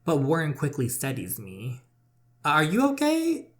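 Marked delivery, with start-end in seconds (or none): 2.4-3.5 high, slow concerned voice; Warren's voice. Acted. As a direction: ask gently, worried for her. Warren asking after stumble.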